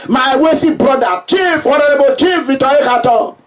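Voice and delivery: ordinary delivery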